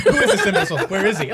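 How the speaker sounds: imitated defiance through laughter; defiant. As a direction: demand it like an affronted villain. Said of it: "like in defiance".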